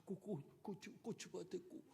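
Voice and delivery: mock stammer from shock; lightly acted shaken stutter. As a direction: imitate a stunned stammer. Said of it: "말을 제대로 못하는 거예요".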